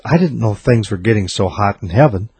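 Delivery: ordinary delivery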